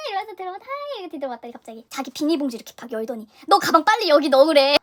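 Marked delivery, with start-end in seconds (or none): 3.5-4.7 loud, insistent retold order; urgent urging. Stranger offering a bag for the rain, loud urgent urging. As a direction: push it out loudly, hurrying someone.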